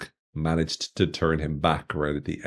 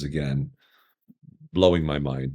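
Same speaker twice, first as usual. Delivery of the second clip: ordinary talk, nothing marked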